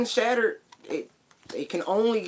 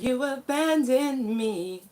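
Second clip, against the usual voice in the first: whiny quote; 6 semitones higher, slower than usual. Retold or imitated. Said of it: "You know how she's like".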